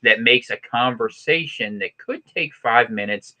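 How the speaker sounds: drawn-out words with long pauses; slow it to a mock Midwestern crawl; very slow Wisconsin talk. Shown as a demonstration. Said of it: "nowhere are things slower".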